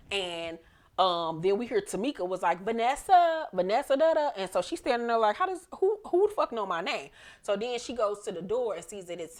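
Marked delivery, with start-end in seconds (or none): 2.6-4.3 higher, louder sing-song call of a name. Retold or imitated. Tamika calling Tasha by her new name outside the door, sing-song and loud. 5.3-7.1 higher, startled rush of panic; alarmed. Retold or imitated. Tasha hiding out, startled that someone knows her name, higher voice.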